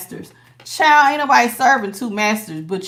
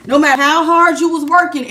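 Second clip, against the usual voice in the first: ordinary delivery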